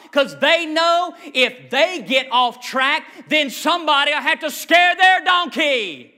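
driving rhythmic sermon, rising to a high, loud peak; preaching, building to full force. Acted. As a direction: pile up each phrase, climbing to a shout.

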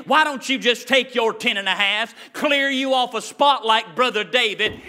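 normal talking voice

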